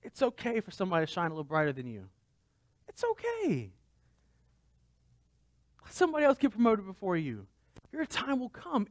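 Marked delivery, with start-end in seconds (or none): 2.9-8.0 high reassurance. Real, not acted. Consoling refrain, pitched up.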